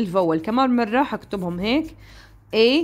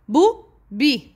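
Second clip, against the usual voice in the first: بو بي as two separate beats; split into syllables; slower than usual. Shown as a demonstration. Teacher counting for pupils, بو بي syllable by syllable. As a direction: leave a clear gap between بو and بي. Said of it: "Two syllables".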